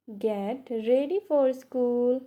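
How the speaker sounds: ordinary talk, nothing marked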